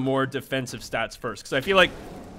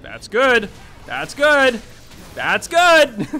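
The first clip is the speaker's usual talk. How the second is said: building excitement; 7 semitones higher, 6 dB louder, much slower than usual. Real, not acted. Rising excitement, repeated praise.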